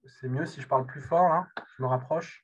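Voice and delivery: speaking up so the room hears. Real, not acted. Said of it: "je peux parler plus fort".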